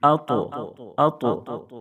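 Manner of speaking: اطو with the ط bounced out heavily; echoing qalqala. Shown as a demonstration. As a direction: give the ط in اطو a clear rebound. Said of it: "یعنی آواز لوٹ جائے گی".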